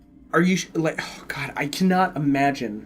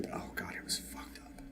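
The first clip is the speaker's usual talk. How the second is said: spooked whisper, much softer; 21 dB quieter than usual. Real, not acted. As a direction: whisper it like the show still creeps you out. Recalling the scary show.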